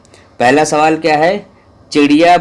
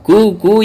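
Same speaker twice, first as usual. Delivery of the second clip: high, louder imitation of a koel's cry; koel call; 9 semitones higher, 3 dB louder, slower than usual. Shown as a demonstration. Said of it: "ये आवाज़ है कोयल की".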